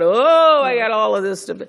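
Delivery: high, loud, rushed burst of panic; a hammed-up flustered rush; mock overwhelmed. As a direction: blurt it like everything is piling up at once. Quoting her own overwhelmed thoughts.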